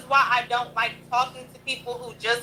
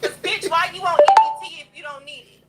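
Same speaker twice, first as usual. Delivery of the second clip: louder, higher, furious jab at the caller; angry outburst; 4 semitones higher, 6 dB louder, faster than usual. Real, not acted. Host ranting at the EBT caller, loud and furious. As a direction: let the anger boil over at her.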